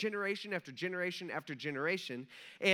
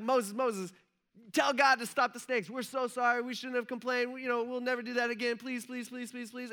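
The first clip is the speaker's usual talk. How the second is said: imitated desperate pleading; 5 semitones higher than usual. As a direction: beg in a mock panic, pitched high. Acted people begging Moses for help.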